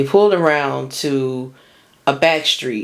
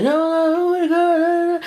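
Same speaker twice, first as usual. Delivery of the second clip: much higher imitated girlfriend, begging; pleading girlfriend; slower than usual. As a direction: squeak out her pleading like a mimic. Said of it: "She's like".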